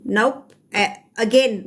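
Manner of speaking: ordinary talk, nothing marked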